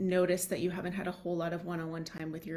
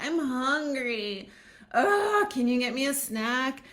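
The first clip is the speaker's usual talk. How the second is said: high, loud, drawn-out whine; whiny hungry kid; 6 semitones higher, 6 dB louder, slower than usual. Retold or imitated. Hungry child whining at a parent for a snack, high and loud. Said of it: "So they're like".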